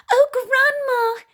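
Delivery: put-on high, slow little girl.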